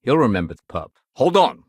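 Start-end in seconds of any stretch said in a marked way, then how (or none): 1.2-1.6 louder, higher call to halt; sharp interjection. Acted. Officer stopping someone mid-matter, raised voice. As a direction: cut in firmly, raising your voice.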